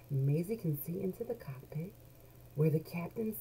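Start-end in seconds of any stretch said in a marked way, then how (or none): none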